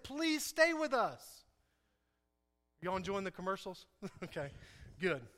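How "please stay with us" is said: high, slow acted plea; earnest entreaty. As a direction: draw out the imploring request. Acting out Lydia's plea to guests.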